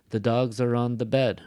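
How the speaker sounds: "dogs" and "bed" strong, "are on the" light; stress on dogs and bed. Shown as a demonstration. Teacher modeling sentence rhythm, strong "dogs" and "bed". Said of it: "The focus word in this sentence".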